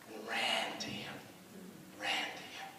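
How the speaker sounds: soft whispered echo; whispered repeat. Acted. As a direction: breathe the words out hushed. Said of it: "repeated line, whispered".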